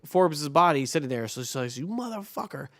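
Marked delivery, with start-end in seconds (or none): 1.8-2.7 high, soft imitation of outrage; lightly acted indignant cussing; mock accusation. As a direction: pitch up the retold curse without volume. Retold sister confronting Forbes' body.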